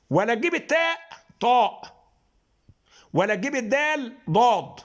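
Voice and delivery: teaching emphatic letters; letter contrast demo. Shown as a demonstration. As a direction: contrast thin التاء with heavy طاء. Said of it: "وما تجيبش".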